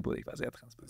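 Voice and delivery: whispered, voice dropped right away; a sly whisper at the end of the brag; ironic whisper. Real, not acted. Whispered to his friend, ironically admitting he was open.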